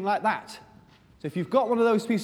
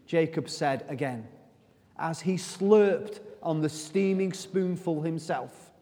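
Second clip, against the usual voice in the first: usual speaking voice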